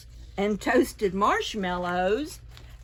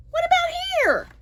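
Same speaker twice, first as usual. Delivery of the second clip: much higher, curious call; squeaky little searcher; 7 dB louder, much faster than usual. A narrator's reading. Little creature searching for a seat, high and curious. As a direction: peek around asking.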